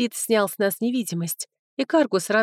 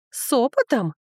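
put-on higher, indignant girlish question; 5 semitones higher than usual. A narrator's reading. Young woman challenging her rival, indignant. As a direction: echo it back in offence. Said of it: "возмутилась первая девица".

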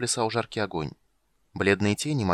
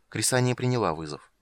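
ordinary delivery, nothing marked

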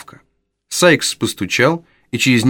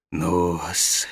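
low, much softer, silky menace; old fence sneers at the runaway boy; insinuating; 4 semitones lower, 3 dB quieter, slower than usual. Acted. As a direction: purr the threat with false kindness. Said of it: "вкратчиво проговорил старый еврей".

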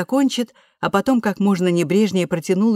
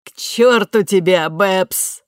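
loud; 2 semitones higher, 5 dB louder, slower than usual. A narrator's reading. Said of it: "добавила".

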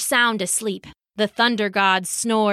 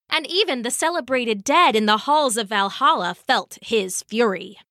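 ordinary delivery, nothing marked

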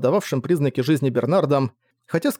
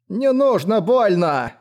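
high, drawn-out pleading whine; childlike whimper; 6 semitones higher, much slower than usual. A narrator's reading. Frightened slave begs not to be hurt, whimpering. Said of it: "захныкал раб и, словно ребёнок-переросток".

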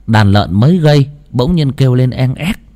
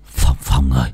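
breathless call; 9 semitones lower, 3 dB quieter than usual. Acted. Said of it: "thở hồng hộc".

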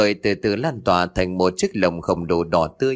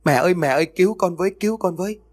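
high, mock frightened cry; retold child's desperate cry; child screaming for help; 9 semitones higher, faster than usual. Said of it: "hét lên lạc cả giọng".

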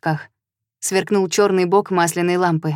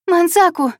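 high, loud cry of alarm; scream; 11 semitones higher, 4 dB louder than usual. Acted. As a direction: cry his name out in fear. Brother buried by snakes.

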